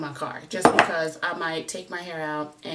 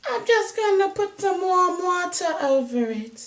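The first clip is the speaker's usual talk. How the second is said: higher, louder mock Jamaican accent, not very convincing; bad Jamaican accent; 12 semitones higher, 6 dB louder than usual. Quoting the Jamaican meme guy to her viewers, in a hammed-up Jamaican accent. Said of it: "That's totally not a Jamaican accent".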